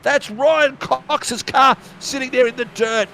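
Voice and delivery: high-pitched, urgent crash commentary; excited race call. Real, not acted. Commentator calling cars going everywhere, excited race call. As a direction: call the pile-up as it unfolds, pitch way up.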